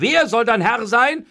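high, loud, incredulous question; aghast. A narrator's reading. Master doubting the servant's answer, aghast. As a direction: demand it again in disbelief. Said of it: "rief Pandolfo entgeistert".

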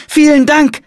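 put-on high, loud child's thanks; grateful girl. A narrator's reading. Girl thanks monk for directions, high and emphatic.

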